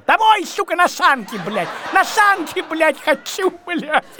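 put-on high, childish wail to go home; wailing like a homesick kid; whiny shout. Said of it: "закричал".

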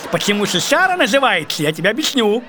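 ordinary talk, nothing marked

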